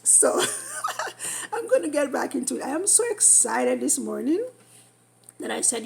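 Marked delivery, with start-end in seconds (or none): 0.5-1.5 high, breathy self-conscious giggle; quick embarrassed giggle; embarrassed laugh. Real, not acted. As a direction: laugh it off at yourself. Laughs at herself.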